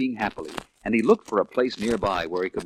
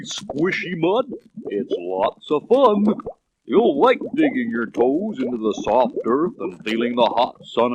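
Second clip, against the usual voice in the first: slow, contented tortoise drawl, higher pitched; lazy tortoise voice; 5 semitones higher than usual. A narrator's reading. Tortoises inviting the roadrunners into the mud, slow and contented. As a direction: lounge in the mud and coax them in.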